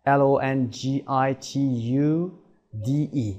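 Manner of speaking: L O N G I T U D E letter by letter, with pauses; spelled out. Shown as a demonstration. Teacher spelling the new word for learners, letter by letter. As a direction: spell L O N G I T U D E in small groups.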